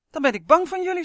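high, loud cry of fear; scared shout. A narrator's reading. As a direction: shout it out, terrified of the creature. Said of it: "schreeuwde hij". (angstig schreeuwen)

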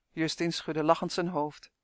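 usual speaking voice

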